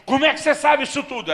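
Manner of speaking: high, loud shocked demand; acted astonished shout; startled disbelief. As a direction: blurt it out in stunned alarm, voice jumping up. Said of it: "ele me olha assustado e diz".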